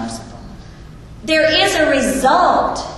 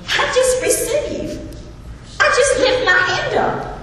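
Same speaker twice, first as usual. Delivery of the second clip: joyful; 11 semitones higher than usual. Real, not acted. Joy at receiving God's gift.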